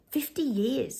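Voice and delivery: hushed, breathy repeat; whispered echo. Real, not acted. Vlogger to viewers on the news, amazed whisper. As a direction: whisper the figure in disbelief.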